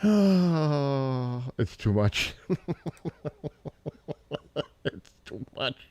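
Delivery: helpless laughter. Real, not acted. Overcome by a funny old clip, helpless laughter. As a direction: laugh until the words barely get out.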